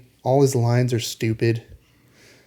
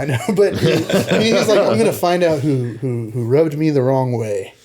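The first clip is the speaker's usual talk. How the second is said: laughing; 3 semitones higher, 4 dB louder than usual. Retold or imitated. Laughing through a villain quote.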